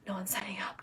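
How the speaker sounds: anxious whispered aside; hushed fretful thought. Retold or imitated. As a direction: whisper the worry to yourself. Inner worried thought.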